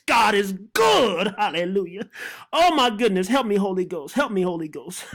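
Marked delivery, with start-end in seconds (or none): none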